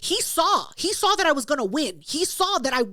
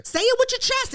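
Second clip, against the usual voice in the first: high-pitched hype-up; rousing pep talk; 10 semitones higher than usual. Retold or imitated. Woman urging a man to stand his ground, rousing. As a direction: egg him on to speak up.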